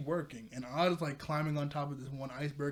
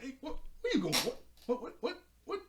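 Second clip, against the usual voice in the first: high-pitched, squeaky run of questions; mock startled crab; 10 semitones higher than usual. Crab comes out of nowhere at Maya, in high mock bafflement. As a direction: imitate a flustered creature, voice jumping way up.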